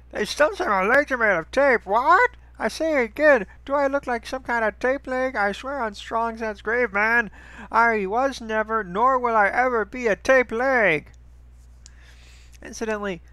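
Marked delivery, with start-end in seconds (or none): 0.1-11.1 high, loud indignant cartoon voice; Strong Bad voice. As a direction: bellow it as an offended cartoon wrestler. Strong Bad answering an email.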